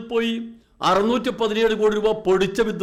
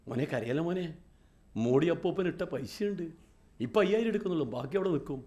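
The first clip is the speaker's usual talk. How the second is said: put-on much softer and lower, a mother coaxing her child; soothing a crying baby; 3 semitones lower, 7 dB quieter than usual. Mother quiets her crying baby, softly. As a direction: lull the baby with a soft promise. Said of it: "അപ്പം കുഞ്ഞ് കരഞ്ഞ്".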